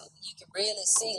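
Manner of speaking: soft unvoiced whisper; whispered aside. Real, not acted. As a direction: breathe it under the voice. Aside while reacting.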